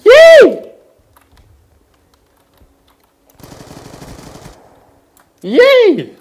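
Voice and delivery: high squealing yay; shrill cheering. Real, not acted. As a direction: squeal with over-the-top glee. Gamer mock cheering at screen.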